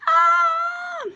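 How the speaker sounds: very high, loud acted scream; high startled shriek; frightened scream. Child sees a smoky figure, frightened scream.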